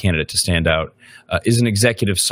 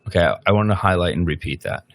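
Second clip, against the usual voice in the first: usual speaking voice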